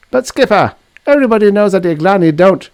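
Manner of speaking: high, loud, fast objection; urgent protest. Acted. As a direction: rush to object before he's cut off. Sandoval protests.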